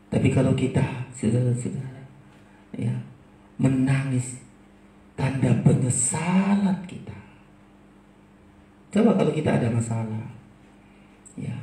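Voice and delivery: ordinary delivery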